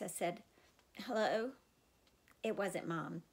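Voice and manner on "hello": sick voice. Pretend. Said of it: "using my sickest voice".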